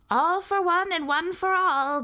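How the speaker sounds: very high, loud and obliging prompt; obliging sentry. A narrator's reading. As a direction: help him out cheerfully, high up. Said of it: "the voice said obligingly".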